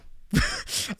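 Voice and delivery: breathy voiceless laugh. Real, not acted. Podcaster laughing at his childhood story, breathy laugh. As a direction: crack up at your own bit.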